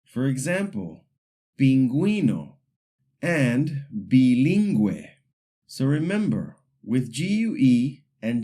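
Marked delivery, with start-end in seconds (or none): 1.6-2.6 pingüino with a gw sound, peen-GWEE-no; Spanish pingüino, ü clearly voiced; pronounced ü. Shown as a demonstration. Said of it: "this U should be pronounced". 3.9-5.1 bilingüe with a gw sound, bee-LEEN-gweh; slow Spanish bilingüe, ü clearly voiced; pronounced ü. Shown as a demonstration. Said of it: "this U should be pronounced".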